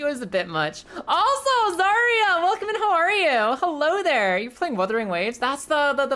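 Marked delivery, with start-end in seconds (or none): 1.1-4.4 high, loud excited welcome; excited greeting. Real, not acted. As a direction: greet the raider with a burst of joy.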